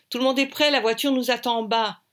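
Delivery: high, loud rallying call; shouted hurry-up across the room; shouting. A narrator's reading. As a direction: call everyone to get moving. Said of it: "crie Audrey".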